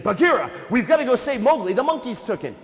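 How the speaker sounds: acted urgency, raise the alarm; urgent plea. Baloo begging Bagheera to save Mowgli, urgent plea.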